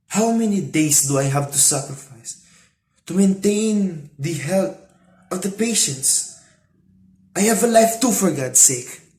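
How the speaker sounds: exasperated outburst. Acted. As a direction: protest loudly as a worn-out nurse. Crying nurse's outburst in a story.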